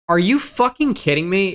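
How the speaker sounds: high, incredulous outburst; exasperated disbelief. Real, not acted. Player cursing a South Korea result, exasperated disbelief. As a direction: protest the result with your pitch jumping up.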